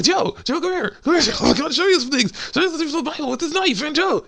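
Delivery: crazed, squealing-high falsetto priest; psychotic priest monster. Retold or imitated. Priest monster with a knife chasing Joe, crazed falsetto. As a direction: coax the victim in a mad, very high voice. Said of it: "just crazy psychotic priest thing".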